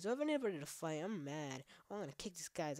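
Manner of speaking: ordinary delivery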